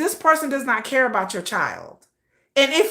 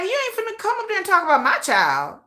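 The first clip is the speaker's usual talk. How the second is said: mock protective mom; 8 semitones higher than usual. Mother quoted, defending her child.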